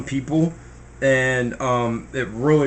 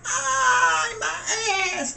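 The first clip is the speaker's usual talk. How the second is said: high mock yelp of pain; pained yell; much slower than usual. Chased by the bull in the field, high mock yelp. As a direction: cry out as the bull gets you. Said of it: "you be like".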